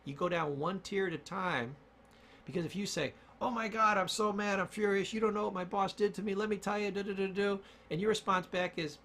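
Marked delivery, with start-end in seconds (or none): 3.4-7.6 higher, rushed venting that spills into nonsense syllables; high, worked-up mock outrage; acted furious rant. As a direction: play someone ranting about the boss. Acted furious rant about boss.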